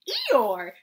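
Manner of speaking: loud, startled call of a name; cry of surprise. Acted. The friends on the bridge shout in surprise at floating Eeyore. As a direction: everyone shouts at once. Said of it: "cried everybody".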